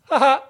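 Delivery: high mocking double laugh, imitated; off-screen laugh. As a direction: mimic a sudden cheeky "ha ha" from off screen. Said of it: "you just hear this".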